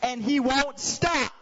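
high, slow, mock teen complaint; hammed-up high-pitched tattling; complaining student. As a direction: imitate an aggrieved kid, stretching the words. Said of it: "And the student goes".

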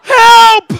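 hammed-up high scream for help; comic panicked scream. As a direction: yell it as loud and high as you can. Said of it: "he starts screaming".

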